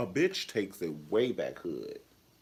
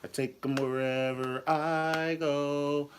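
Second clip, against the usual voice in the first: mock toy ad; 8 semitones higher, 3 dB louder, slower than usual. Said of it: "you be wanting to get a".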